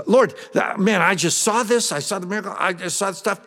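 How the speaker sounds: high, breathless eager telling; excited young man. Acted. Young man telling Jesus what he saw, eager and high. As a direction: blurt it out like a starstruck kid. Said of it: "He says".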